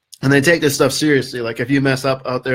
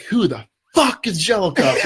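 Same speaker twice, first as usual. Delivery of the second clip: imitating an angry sergeant major; 5 semitones higher than usual. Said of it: "the sergeant major is like".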